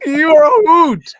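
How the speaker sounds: high, loud laughing words; laughing while talking. Real, not acted. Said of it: "I'm sorry I'm laughing at you".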